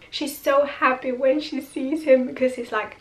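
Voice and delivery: ordinary talk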